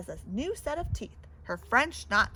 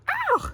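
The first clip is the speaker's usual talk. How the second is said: much higher sharp yelp; pained ow; 7 dB louder than usual. Acted. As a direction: yelp at the pinch. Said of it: "She cried".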